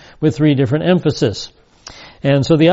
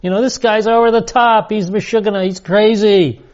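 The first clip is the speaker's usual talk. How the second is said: higher, scoffing voice dismissing him as crazy; mocking gossip; 6 semitones higher than usual. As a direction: sneer like a gossip in the crowd. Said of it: "He's being mocked".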